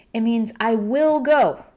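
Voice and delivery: normal talking voice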